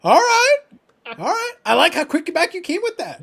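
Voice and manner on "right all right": high delighted all right; amused. Real, not acted. Cheering a cohost's quick comeback with a high whoop.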